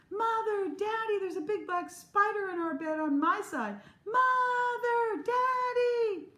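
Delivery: put-on high, loud child's screaming for parents. Frightened girl runs screaming to her parents' room, loud high panic. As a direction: scream for Mother and Daddy in panic. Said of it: "went screaming".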